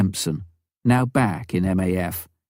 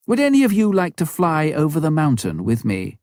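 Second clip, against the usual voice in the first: higher, eager invitation; eager offer; 8 semitones higher than usual. A narrator's reading. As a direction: ask brightly, hoping for a yes.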